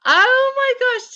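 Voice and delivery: very high, drawn-out gush; touched delight. Real, not acted. Host moved that Mum asked after her, delighted.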